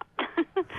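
short high bright laugh; light laugh. Real, not acted. Laughs after explaining voice.

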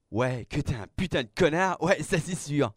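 high-pitched heated outburst; indignant insult. Acted. Angry insult after being hurt. (insulte indignée d'une voix aiguë)

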